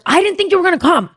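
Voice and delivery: high, hurried protest, acted out; mock indignant reproach. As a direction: throw it back at him in an exasperated imitation. Acted reproach to late friend.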